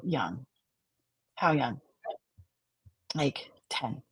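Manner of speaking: hushed, small client answers; softly, as if drifting inward; quiet. Shown as a demonstration. Therapy dialogue, child-state client.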